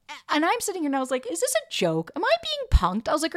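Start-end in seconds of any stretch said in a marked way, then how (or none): 1.3-3.1 high-pitched retold disbelief; incredulous. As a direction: pitch leaps up as you act out outraged questions. Retelling disbelief during the breakup.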